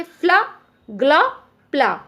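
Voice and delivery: "फ्ला, ग्ला, प्ला" each as one short blended syllable; one blended sound. Shown as a demonstration. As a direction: give "फ्ला, ग्ला, प्ला" singly, with pauses between. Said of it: "इसी तरीके से".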